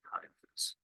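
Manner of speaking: "chi, a, thos" with "chi" as one syllable like "eye"; English pronunciation. Shown as a demonstration. Teacher giving the translated name, "chi, a, thos" in English. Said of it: "which we pronounce in English".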